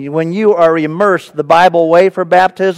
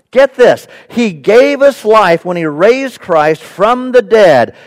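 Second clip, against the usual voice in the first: ordinary talk, nothing marked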